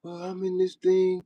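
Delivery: high, careful put-on proper voice; mock proper churchgoer. Streamer mimicking how Christians talk, mock proper. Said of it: "change their voice and start trying to talk proper".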